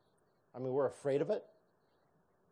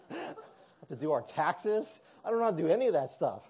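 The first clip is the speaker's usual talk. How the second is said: higher, louder and fast, hammed-up worry; mock panic; 5 semitones higher, 5 dB louder than usual. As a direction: rattle it off in fake alarm. Mock panic about chores.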